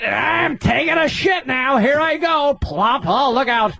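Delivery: high, drawn-out hammed-up announcement.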